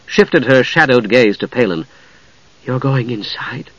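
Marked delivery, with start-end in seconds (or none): 2.7-3.7 soft, slow uneasy question; fearful. A narrator's reading. Usha questioning Palin about entering the tower, hushed fear. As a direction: ask slowly, hushed with dread. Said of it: "looked back fearfully".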